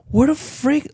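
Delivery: high, incredulous; can't believe your eyes; baffled. Retold or imitated. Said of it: "and I was like".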